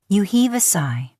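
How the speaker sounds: ordinary delivery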